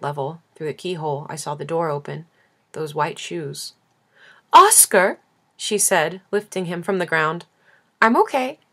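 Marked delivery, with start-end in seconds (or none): 4.5-5.2 high, loud joyful call of the name; excited call. Acted. Grandmother greeting her grandson, loud joyful call. 8.0-8.6 a hint of a high, louder little boy; lightly voiced child brushing it off; young boy's voice. Grandson answering his grandmother, lightly voiced child. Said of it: "That song in his voice".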